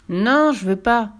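loud stubborn no; boy refusing the witch; refusing child. Acted. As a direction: refuse outright.

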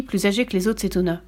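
ordinary delivery

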